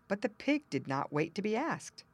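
plain reading voice

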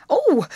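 high, loud cry of alarm; startled scream. A narrator's reading. Girls hearing of snakes, startled scream. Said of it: "screamed the girls in chorus".

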